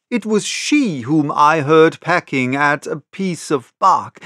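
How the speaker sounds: plain reading voice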